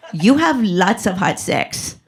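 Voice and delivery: put-on slow, robotic foreign-accented doctor; cute ESL-robot accent. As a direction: deliver a diagnosis in a halting ESL accent. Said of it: "sounded like a cute little robot took E S L classes".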